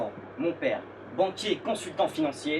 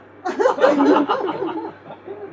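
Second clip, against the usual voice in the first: big audience laugh; crowd laughter; 9 dB louder than usual. Real, not acted. Audience reacts.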